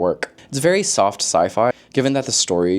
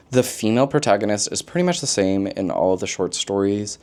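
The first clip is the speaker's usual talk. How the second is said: usual speaking voice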